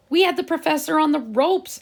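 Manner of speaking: high, heated argument; arguing. A narrator's reading. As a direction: push the point heatedly. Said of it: "argued Benji".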